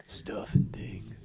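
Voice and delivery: slow breathy whisper. Pretend. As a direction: hush it out slowly. Repeating catchphrase in whisper.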